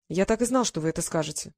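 plain reading voice

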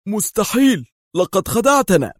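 high-pitched, shocked cry; astonished, outraged shout; stunned exclamation. A narrator's reading. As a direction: cry out in disbelief. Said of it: "في ذهول وهو يهتف".

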